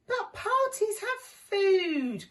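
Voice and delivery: high, slow complaining character voice; grumbled. Acted. Sam worrying about the food, high and slow. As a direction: whine the problem out. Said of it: "grumbled Sam".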